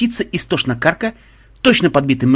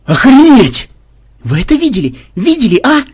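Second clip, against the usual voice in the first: very high, loud disbelief; high-pitched, incredulous yelling; stunned outcry; 10 semitones higher, 8 dB louder than usual. Acted. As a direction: exclaim in shock to your friends. Player reacts to being killed.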